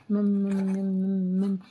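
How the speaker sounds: put-on slow, droning mumble of a small baby; imitated baby babble. Teacher showing how a small child babbles, imitated droning babble. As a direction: imitate an infant's drawn-out "mum-mum" hum. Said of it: "देखो बच्चा छोटा होता है".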